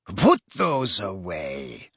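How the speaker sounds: low, slow, icy command; cold. A narrator's reading. Mother to the two boys with wands, cold. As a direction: order them coldly, without raising the voice. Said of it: "she said coldly".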